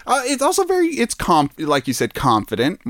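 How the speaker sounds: usual speaking voice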